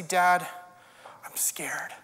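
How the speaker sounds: put-on a small, hushed child's voice, almost whispered; scared child. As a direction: whisper it like a frightened kid to dad. Child tells parent its fear.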